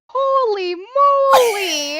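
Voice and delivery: put-on slow, high, loud astonished child; imitating a thrilled kid, high and slow; excited little kid. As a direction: stretch it out in wonder at the presents. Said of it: "you just hear me go".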